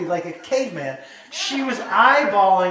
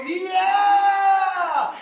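high, long-held crowd cheer; huge drawn-out yeah; 8 semitones higher than usual. Retold or imitated. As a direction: stretch the yeah into an eager howl. Crowd's final escalated answer.

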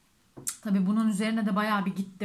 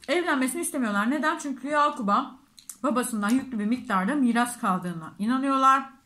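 speaking while chewing behind hand; muffled through hand, mouth full; talking with mouth full, hand covering; 2 semitones higher than usual. Real, not acted.

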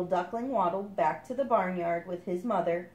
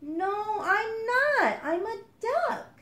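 much higher, proud little duckling; the duckling proudly answering the kingfisher, higher; 14 semitones higher, 4 dB louder, much slower than usual. Acted. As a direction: stand tall and insist. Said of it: "said the little duckling proudly".

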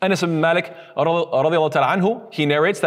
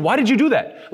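higher-pitched, imitated scolding; mock frustration; 6 semitones higher than usual. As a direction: act out an exasperated reproach. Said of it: "expressing our disappointment and frustration".

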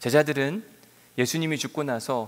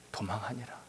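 whispered, dropping to a hush; dramatic whisper; 5 semitones lower, 12 dB quieter than usual. Acted. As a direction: let the words fade to a breath. Whisper for effect in sermon. (속삭이듯 조용히)

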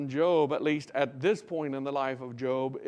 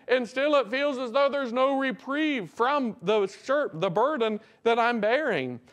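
higher, fervent preached confession; Job; 8 semitones higher, 4 dB louder than usual. Acted. Preacher voicing Job confessing sin to the Lord, fervent pleading. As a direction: plead up to heaven with mounting intensity. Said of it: "Basically, Job is there saying".

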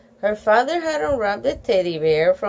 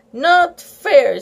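loud, higher pouting complaint; 5 semitones higher, 7 dB louder than usual. A narrator's reading. Girl sulking at being left out, pouting. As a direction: whine it with the lip out. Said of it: "sticking out her lower lip".

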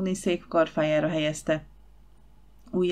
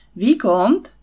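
furious call; 3 semitones higher, 7 dB louder than usual. Acted. Olympia storms in, furious.

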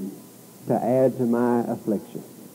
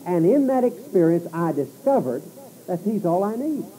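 higher, ringing preaching voice; preacher speaking as Paul to the congregation, voice lifted; preaching paraphrase; 6 semitones higher than usual. Acted. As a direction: testify it as Paul, lifting the pitch. Voicing Paul's testimony.

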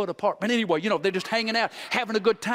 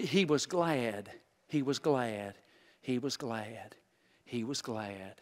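fading repetition; 7 semitones lower, 6 dB quieter, slower than usual. Acted. Repeating scripture phrase, fading.

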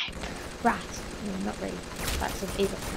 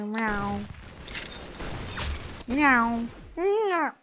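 playful cat meow; 3 semitones higher, much slower than usual. Pretend. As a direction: mimic a cat, pitch rising to a squeal. Imitating a cat during play.

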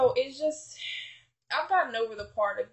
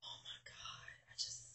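overwhelmed whisper; 18 dB quieter than usual. Real, not acted. Overwhelmed, blushing at God.

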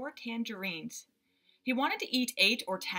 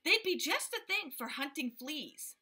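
higher, bright helpful offer; eager young explorer; 6 semitones higher than usual. A narrator's reading. Father offering magnifying glasses to the gorilla, higher. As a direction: pitch the idea like a clever boy. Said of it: "said my father".

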